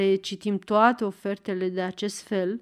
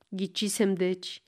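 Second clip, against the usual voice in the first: usual speaking voice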